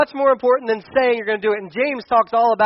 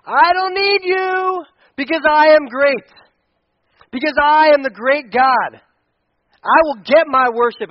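higher, louder, slow proclamation; God declaring greatness; 6 semitones higher, 8 dB louder, slower than usual. Retold or imitated. Pastor voicing God's greatness to the people, loud and lofty. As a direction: thunder it out as mighty God. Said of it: "he comes back to".

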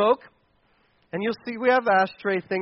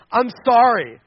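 loud, insincere mock apology; grudging apology; 7 dB louder than usual. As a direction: blurt out a forced sorry, like a sibling made to apologize. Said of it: "And then you storm".

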